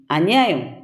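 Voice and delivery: usual speaking voice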